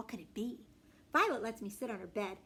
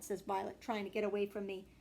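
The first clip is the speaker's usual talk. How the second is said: ordinary delivery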